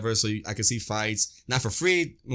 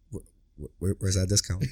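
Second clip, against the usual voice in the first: stammering disbelief; 5 semitones lower than usual. Retold or imitated. Stammering at the bill.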